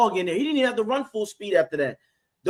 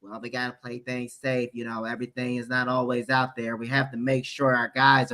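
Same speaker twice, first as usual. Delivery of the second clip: low, much softer, mumbling coach voice; Bowles impression; 6 semitones lower, 4 dB quieter than usual. Mocking Bowles urging caution, low and soft. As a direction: imitate a timid defensive coach. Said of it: "That's a really good impression".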